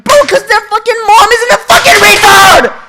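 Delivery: high, screeching outburst at full volume; furious shout. Retold or imitated. Son acts out snapping at mom over cousins, furious scream. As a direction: explode into a high-pitched scream. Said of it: "I'm like".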